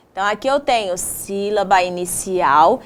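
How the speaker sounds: usual speaking voice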